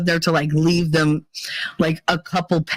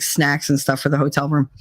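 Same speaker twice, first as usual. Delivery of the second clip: ordinary talk, nothing marked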